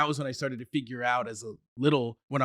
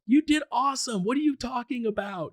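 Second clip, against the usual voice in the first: higher, upbeat reassurance; cheering friends; 11 semitones higher than usual. Retold or imitated. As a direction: brush off his worry with enthusiasm. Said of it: "They're like".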